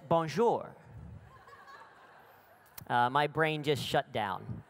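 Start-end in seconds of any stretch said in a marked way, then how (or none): 0.1-0.7 lightly voiced French clerk, brief and polite; a quick French hello from the desk; a hint of a French receptionist. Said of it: "the woman says".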